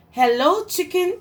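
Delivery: high bright chirpy hello; cheery duck greeting. Acted. Duck calls out to Cow as he rides past, high and cheery. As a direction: greet like a happy little duck on a bike.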